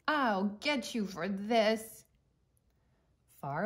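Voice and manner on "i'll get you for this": shouting. Acted.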